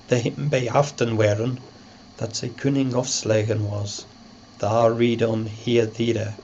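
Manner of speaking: put-on reading the Old English text aloud. Shown as a demonstration. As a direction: recite it in Old English pronunciation. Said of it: "The text".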